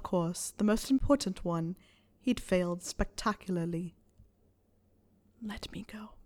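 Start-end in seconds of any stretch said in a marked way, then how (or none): 5.4-6.1 very soft whisper; hushed, barely voiced request; defeated plea. A narrator's reading. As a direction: whisper it, giving up. Harry's defeated plea after failing.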